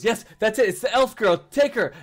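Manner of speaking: high, loud goblin outburst; loud, urgent little goblin pointing out the culprit; excited goblin accusation. Acted. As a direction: cry out the accusation at a high pitch. Goblin identifies the elf to guards.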